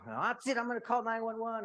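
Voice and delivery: high, loud ultimatum; imitated outraged threat. Husband imitating his wife threatening to call police, outraged. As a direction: shout it high, as a final threat.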